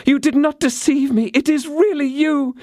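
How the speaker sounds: put-on high, breathless young woman's joy; overjoyed young woman greeting her lover; Constance, overjoyed. A narrator's reading. Constance greets D'Artagnan, high and overjoyed.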